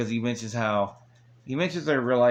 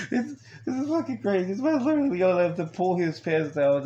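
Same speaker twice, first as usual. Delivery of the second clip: excited disbelief; 8 semitones higher than usual. Real, not acted. Reacting to crazy scene.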